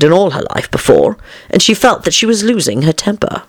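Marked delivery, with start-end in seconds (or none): none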